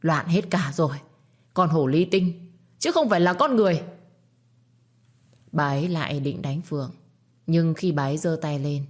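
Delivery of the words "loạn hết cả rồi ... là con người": building to a loud, fast rant; an enraged mother-in-law lashing out; furious. Acted. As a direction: let the fury spill over faster and louder. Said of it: "càng điên lên".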